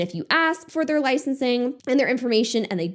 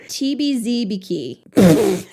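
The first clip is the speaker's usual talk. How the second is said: odd voice; 4 dB louder, slower than usual. Real, not acted. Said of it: "Why did I say it like that".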